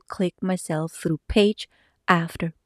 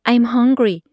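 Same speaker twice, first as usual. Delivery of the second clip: a hint of a whining kid, louder; calling child; 3 semitones higher, 6 dB louder, slower than usual. Daughter comes round the corner, calling hungrily. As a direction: call out to mom, lightly childlike.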